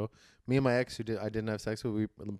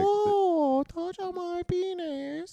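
very high, slow falsetto coo; much slower than usual. Shown as a demonstration. Repeating the demo for the co-host, drawn-out falsetto. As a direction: drag it out in a hammed-up squeal.

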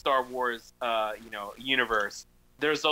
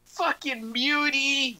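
bigot sneer; 12 semitones higher, much slower than usual. Retold or imitated. Quoting bigots attacking mutants.

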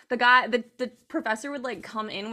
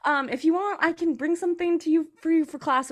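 sweet text voice; 6 semitones higher than usual. Retold or imitated. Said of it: "send me a nice little text message being like".

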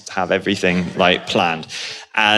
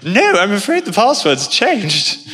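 high, slow imitated British voice; weird British accent; 9 semitones higher than usual. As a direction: put on an odd British accent, drawn out and high. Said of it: "in this really weird British accent".

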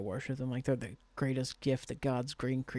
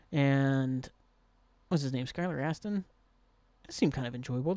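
ordinary talk, nothing marked

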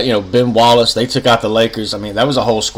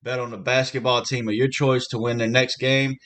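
upbeat sponsor read; 10 dB quieter than usual. Acted. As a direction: sell it like a radio spot. Host reads sportsbook ad.

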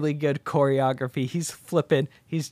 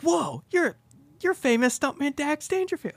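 much higher awestruck fan voice; starstruck Rod; 14 semitones higher than usual. Retold or imitated. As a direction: gush at a famous stuntman. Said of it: "And Rod's like".

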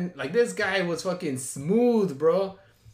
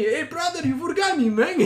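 very high, louder put-on accented yell; mock calling out; 13 semitones higher, 5 dB louder than usual. Abandoned date calling after fleeing driver, comic yell. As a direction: call after a car in a hammed-up accent.

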